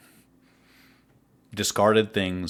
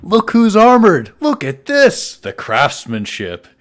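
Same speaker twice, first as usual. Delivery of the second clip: much higher and louder, booming with glee; hearty delight; 11 semitones higher, 12 dB louder, slower than usual. Acted. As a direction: slap on the back, admiring the armor. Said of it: "He leans down to you and says".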